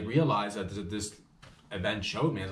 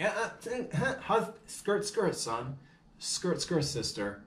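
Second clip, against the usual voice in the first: startled reaction; 7 semitones higher, slower than usual. Retold or imitated. Acts out his startled reaction to husband.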